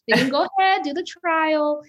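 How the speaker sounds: high, loud laughing encouragement; bubbling laughing push. Real, not acted. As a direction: cheer them on through a laugh.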